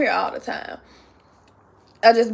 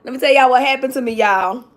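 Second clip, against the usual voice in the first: usual speaking voice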